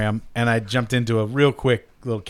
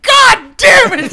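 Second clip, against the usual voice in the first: loud, very high, drawn-out yell; exploding in mock outrage; furious group outcry; 10 dB louder, slower than usual. Game group reacting to a natural hundred, furious yell. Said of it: "so angry".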